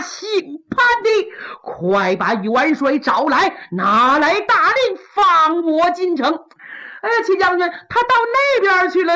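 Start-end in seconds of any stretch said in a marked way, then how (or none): 0.0-6.4 a cracked whisper, then slow and deliberate; strained slow demand. Acted. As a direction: spell out each demand slowly, worn out. Exhausted general pleads, demands.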